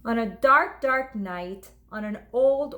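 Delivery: plain reading voice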